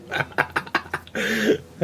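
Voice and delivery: high airy laugh under the breath; breathy giggle. Real, not acted. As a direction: giggle at your own mistake. Laughs at a glue mishap.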